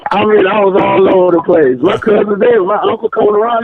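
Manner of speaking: ordinary talk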